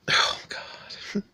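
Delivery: breathless whisper of Oh, God; overwhelmed whisper; real whispered gasp. Real, not acted. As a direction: let it slip out in a whisper. Overcome, breaks off speech.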